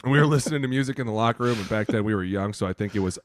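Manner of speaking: usual speaking voice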